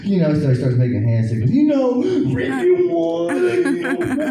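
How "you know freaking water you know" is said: high, hammed-up Brooklyn Puerto Rican accent. Sweaty Brooklyn coworker begging for water, high mock accent. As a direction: squeal it out like a thirsty New Yorker. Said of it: "He's talking that way".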